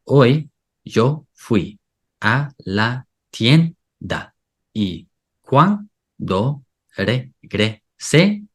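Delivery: "Hoy yo fui a la tienda y cuando regresé yo encontré mi perro en el piso" very slowly, a pause after each syllable. Shown as a demonstration. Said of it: "syllable by syllable".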